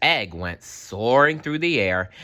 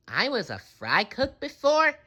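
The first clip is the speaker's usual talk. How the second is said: unimpressed. A narrator's reading. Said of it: "asked SpongeBob, unimpressed".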